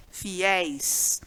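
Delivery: fiéis drawn out on an open, stressed é. Shown as a demonstration. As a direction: say "fiéis" low and slow, leaning on the open é. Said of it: "um acento no é".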